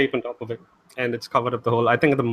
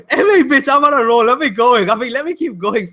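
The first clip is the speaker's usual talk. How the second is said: laughing; 12 semitones higher, 5 dB louder than usual. Real, not acted. Laughing mid-rant.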